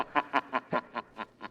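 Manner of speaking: low soft sinister chuckle; villain's gloating laugh. Acted. Villain gloating that all will die, sinister chuckle. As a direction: chuckle over the victims.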